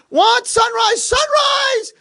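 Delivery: very high, loud hollering; excited shout. Retold or imitated. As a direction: announce it as thrilling news to a sleeper. Roommate keeps calling out sunrise.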